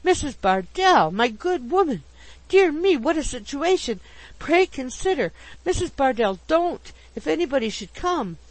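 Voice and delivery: high, loud flustered pleading; astonished protest. A narrator's reading. Pickwick begs his clinging landlady to let go, astonished. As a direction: remonstrate in alarm at the embrace. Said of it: "cried the astonished Mister Pickwick".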